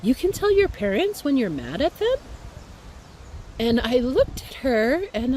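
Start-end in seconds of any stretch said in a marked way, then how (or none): 0.0-2.2 amazed friend. Retold or imitated. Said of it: "she said".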